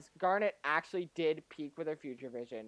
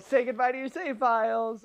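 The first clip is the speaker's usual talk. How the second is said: higher playful teasing voice; gleeful taunt; 6 semitones higher than usual. Retold or imitated. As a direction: tease with mischief. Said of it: "You know".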